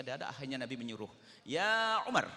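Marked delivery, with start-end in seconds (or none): 1.5-2.3 calling out. Acted. Prophet calls out to Umar.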